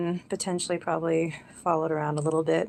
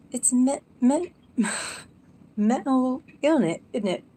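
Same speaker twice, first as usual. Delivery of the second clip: high, louder mock British accent; 8 semitones higher, 5 dB louder than usual. Trying out grandma's Sussex accent, hammed-up British. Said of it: "if I'm gonna do that accent".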